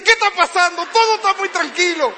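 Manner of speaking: high, loud startled question; mock panicked father; alarmed parent. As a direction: jolt awake, worried by the silence. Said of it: "uno se despierta y dice".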